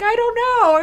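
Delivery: very high, loud squealed protest; mock helpless wail. College girl teased by the firemen over breakfast, high helpless wail. Laughing at her own silliness.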